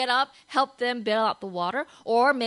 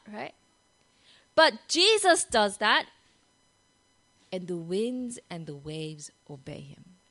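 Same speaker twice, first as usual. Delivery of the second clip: normal talking voice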